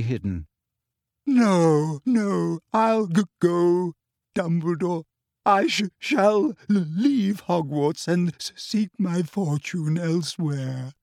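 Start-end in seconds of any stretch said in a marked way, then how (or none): 1.3-10.9 slow, broken words, choking back sobs; Trelawney fighting tears, stuttering; tearful stammer. A narrator's reading.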